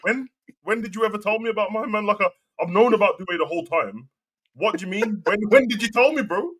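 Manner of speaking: high-pitched incredulous protest; retold challenge, pitched high in disbelief; indignant disbelief. Answering a man who claims he told him, high indignant disbelief. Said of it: "I'm like".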